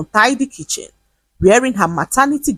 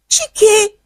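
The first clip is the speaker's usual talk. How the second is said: screamed. A narrator's reading. Wife screaming at husband over poop on floor, high scream. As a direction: yell his name in shock. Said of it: "she screamed".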